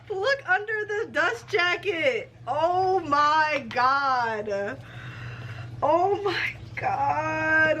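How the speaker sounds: high, drawn-out astonishment; amazed delight. Real, not acted. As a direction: stretch it out, stunned and thrilled. Discovers what is under dust jacket.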